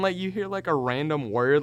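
ordinary talk

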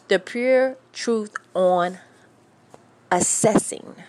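"assessing" set apart after a pause; said carefully. Shown as a demonstration. Host announces the topic word, taking her time on "assessing". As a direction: take your time saying "assessing". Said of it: "take your time when you say it".

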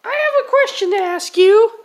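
put-on squeaky high-pitched kid voice; high character voice.

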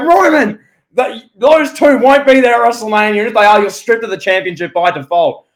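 high, loud authoritative yell at Roman; commanding shout. Retold or imitated. Voicing Aldis shouting at Roman.

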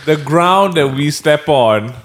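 mock grand speech. Retrying a friend's line as a solemn slogan. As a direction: pronounce it like a national motto. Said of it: "I will give you a try again".

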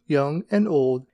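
ordinary delivery, nothing marked

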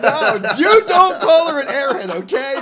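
high, loud scolding laugh; mock outrage. Real, not acted.

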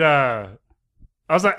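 normal talking voice

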